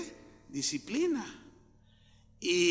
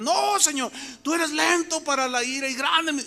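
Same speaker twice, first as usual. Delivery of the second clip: loud, high-pitched pleading; Moisés interceding; 8 semitones higher, 5 dB louder than usual. Retold or imitated. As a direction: plead urgently with God for the people. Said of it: "empieza a interceder por ellos".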